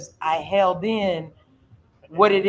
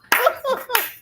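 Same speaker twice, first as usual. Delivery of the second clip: real laugh, much higher; high burst of laughter. Real, not acted. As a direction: laugh out in relief. Laughter at being helped.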